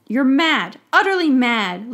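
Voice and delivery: loud, outraged yell; furious shout. A narrator's reading. Professor yells at the minister in outrage. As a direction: hurl the accusation at him.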